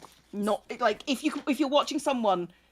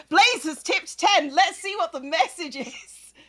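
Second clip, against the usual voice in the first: excited; 8 semitones higher, 4 dB louder than usual. Real, not acted. Streamer reacting to donation.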